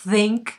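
"think" stretched with a long blown th; exaggerated th. Shown as a demonstration. Teacher showing an exaggerated th. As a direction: overdo the air on "think". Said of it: "al principio es exagerado".

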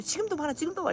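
high, pointed mock accusation; teasing friends. Friends catching her being cute, high teasing. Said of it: "이러는 거야, 그냥".

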